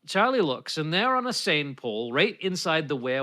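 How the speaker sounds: usual speaking voice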